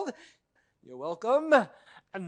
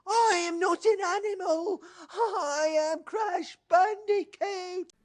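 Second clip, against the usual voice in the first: indignant declaration; 5 semitones higher, slower than usual. Acted. Indignant outburst.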